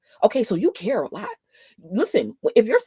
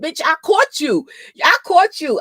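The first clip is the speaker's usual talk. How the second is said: triumphant callout; 8 semitones higher, 7 dB louder than usual. Real, not acted. Catches commenter editing comment.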